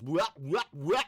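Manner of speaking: loud nonsense syllables parodying Australian speech; Aussie vowels reduced to "wah"; mock Australian twang. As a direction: caricature the Australian accent as noises. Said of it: "Everything is".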